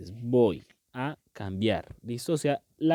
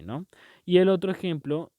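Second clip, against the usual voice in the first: ordinary talk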